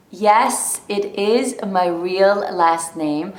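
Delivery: ordinary talk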